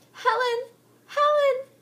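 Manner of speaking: very high loud shriek. Acted. Heather shrieking for Helen. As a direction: scream her name into the storm. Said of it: "Heather shrieked".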